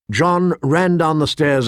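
ordinary delivery, nothing marked

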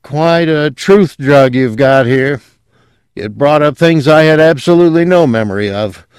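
high, loud character voice, musing; lightly voiced drugged man, marvelling; pondering. A narrator's reading. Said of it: "he pondered".

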